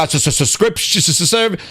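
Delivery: stammering on every S; mock stutter. Showing how the CEO doesn't talk, mock stutter. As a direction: get stuck repeating each opening sound. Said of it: "Satya is not stuttering. He's not like".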